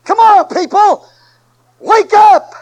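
high, loud, slow call to wake them; rousing shout. Acted. Preacher shouting to rouse his sleepy congregation. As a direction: shake the room awake.

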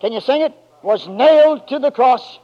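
loud, very high, stretched shouts of praise; full-force preaching. Acted. Preacher rousing the church to rise up, loud and very high. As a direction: lift the whole church to its feet.

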